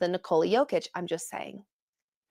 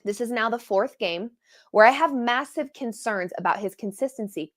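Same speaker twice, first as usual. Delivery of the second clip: ordinary delivery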